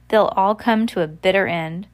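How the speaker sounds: ordinary delivery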